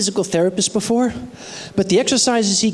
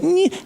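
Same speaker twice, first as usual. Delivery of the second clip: high pained grunt; a short mock strain noise; 13 semitones higher than usual. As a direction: grunt as you lift the arm. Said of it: "So I went".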